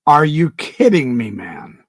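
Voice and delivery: ordinary talk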